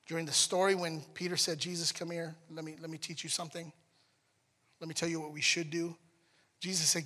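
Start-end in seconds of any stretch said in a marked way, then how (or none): 1.6-5.9 hushed. Retold or imitated. Peter voiced lecturing Jesus.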